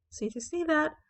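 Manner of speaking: ordinary talk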